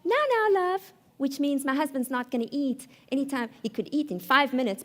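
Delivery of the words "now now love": high sing-out brush-off; placating wife. Retold or imitated. Wife putting off husband's supper, high placating voice. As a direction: fob him off in a high voice. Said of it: "I would say something like".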